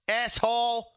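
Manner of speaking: loud, high burst of insult; angry shout. Pretend. Cursing whoever took the dollar, loud angry yell. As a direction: yell it at the culprit.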